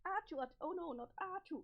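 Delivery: fighting off a sneeze; sneezing. Acted. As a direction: panicky sneeze coming on. Sneezing, dreading the next one.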